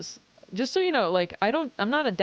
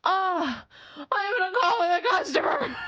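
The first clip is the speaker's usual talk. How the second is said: higher half-crying whine; mock pained cry; 11 semitones higher than usual. Streamer retells cat clawing during a customer call, mock pained cry. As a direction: whimper it like the claws hurt. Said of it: "and I'm like".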